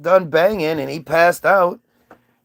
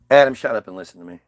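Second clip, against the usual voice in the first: much lower, fast stern voice; low rapid stern order; Eve's new voice; 10 semitones lower, much faster than usual. Retold or imitated. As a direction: cut him off, deadly serious. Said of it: "why your voice so different".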